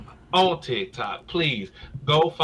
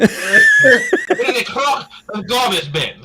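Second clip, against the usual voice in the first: put-on slow, much higher, louder British accent through laughter; hammed-up British accent; 10 semitones higher, 5 dB louder, slower than usual. Redoing the answer to Ben, exaggerated laughing British accent. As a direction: ham up a British accent while cracking up. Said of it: "sound like the west side of Britain".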